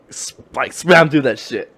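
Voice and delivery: ordinary talk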